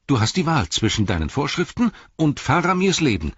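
emphatic pleading hobbit; insistent Pippin. Acted. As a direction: force the choice on them, climbing in pitch. Pippin confronts Beregond with choice.

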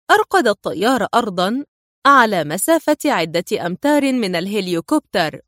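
ordinary delivery, nothing marked

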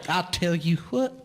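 high, hammed-up cowboy voice; exaggerated cowboy twang; mock cowboy. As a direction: play the wannabe cowboy. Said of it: "they have adopted the cowboy persona".

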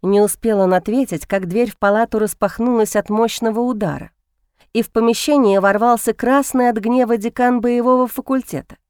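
ordinary delivery

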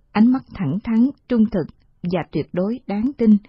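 plain reading voice

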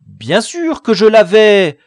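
high, loud anxious protest; agitated retort; very worried. A narrator's reading. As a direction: insist loudly, voice pushed up with worry. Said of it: "répondit le chef très inquiet".

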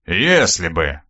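low, grudging grumble; understated grumpy reply; disgruntled grumble. A narrator's reading. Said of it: "проворчал недовольный дружинник".

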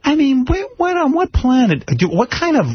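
high-pitched, stammering disbelief; baffled exasperation, words stretched and halting; incredulous. Real, not acted. Radio host ranting about a cheating politician, incredulous and high.

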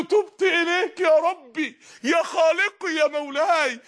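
high, slow, crying plea; tearful prayer. Acted. Repentant believer praying to God, slow crying plea. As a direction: beg God through tears.

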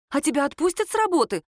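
suddenly anxious. A narrator's reading. Girl asks whether work will release him, suddenly anxious. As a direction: ask with a jolt of concern. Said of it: "вдруг разволновалась Лера".

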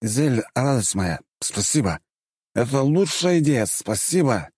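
slow, slurred mock-drunk thanks. Hero pretends to be drunk thanking Izel. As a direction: play it tipsy and effusive. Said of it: "говорить всё это пьяным голосом".

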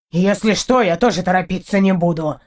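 understated vengeful retort; vindictive. A narrator's reading. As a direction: answer back with a touch of payback. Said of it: "мстительно ответил кот".

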